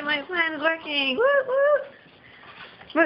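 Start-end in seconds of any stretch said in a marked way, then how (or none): 1.2-3.0 high little victory cheer; high gleeful woo; excited cheer. Real, not acted. Celebrating plan working.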